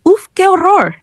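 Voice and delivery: high-pitched horrified gasp of words; startled exclamation. A narrator's reading. Player is stabbed in game, startled exclamation. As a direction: exclaim high, taken aback. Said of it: "exclamó con un sobresalto".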